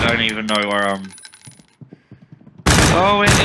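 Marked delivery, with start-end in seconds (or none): 3.0-3.4 sudden high outburst; surprised. Real, not acted. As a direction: caught off guard, loud. Realizes friendly fire is on.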